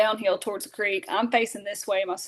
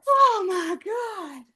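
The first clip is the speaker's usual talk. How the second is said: much higher, slow, drawn-out shock; stunned gasp; 10 semitones higher, 3 dB louder, much slower than usual. Retold or imitated. Sisters reacting as dad shoots both animals, stunned. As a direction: let it out in disbelief. Bobcat and turkey shot.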